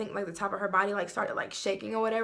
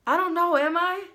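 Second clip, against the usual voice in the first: higher, louder mock of a confused voice; imitating his dazed friend; 9 semitones higher, 6 dB louder than usual. His friend answering, in a higher mock-confused voice. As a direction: play her lost and unsure, pitched up.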